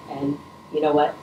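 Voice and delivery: normal talking voice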